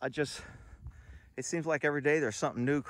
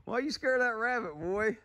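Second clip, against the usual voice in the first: teasing pet talk; 9 semitones higher than usual. Real, not acted. Owner teasing dog about rabbit.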